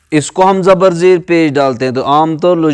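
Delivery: ordinary talk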